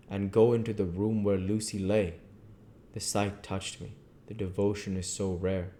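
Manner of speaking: ordinary delivery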